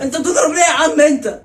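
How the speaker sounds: high, loud, hurt complaint; pained protest. Acted. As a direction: yelp in protest after a blow. Complains after being hit.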